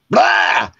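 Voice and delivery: sudden high, loud scare noise; startling "blah" yell. Retold or imitated. Sneaking up behind Mom to scare her, sudden loud yell. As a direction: burst out to make someone jump. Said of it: "I just scared her".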